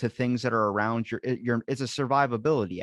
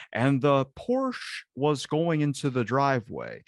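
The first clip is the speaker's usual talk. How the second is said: slow, put-on broadcaster delivery; radio talk; 2 semitones higher, slower than usual. Shown as a demonstration. Showing how people from some places talk, radio talk. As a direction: draw the words out like a radio announcer. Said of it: "it's like radio talk".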